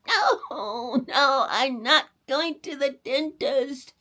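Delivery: high, loud, slow fearful wail; a scared boy refusing the dentist; crying out. A narrator's reading. Boy cries out to his mother he won't go, fearful. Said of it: "cried the boy".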